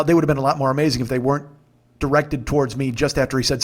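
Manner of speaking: ordinary talk, nothing marked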